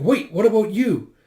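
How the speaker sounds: high, loud worried question; alarmed protest. Retold or imitated. Worried for the one staying behind, alarmed. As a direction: object urgently.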